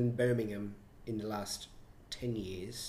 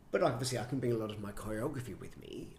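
ordinary talk, nothing marked

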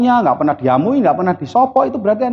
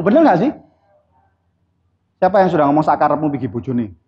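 usual speaking voice

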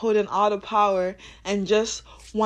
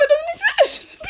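high, loud laugh through it; 7 dB louder than usual. Real, not acted. Laughing as she declares it gone. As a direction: declare it while cracking up.

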